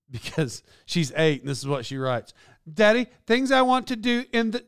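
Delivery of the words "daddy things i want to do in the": put-on high, eager child's voice; little girl reading. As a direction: read it like an eight-year-old. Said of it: "she's reading".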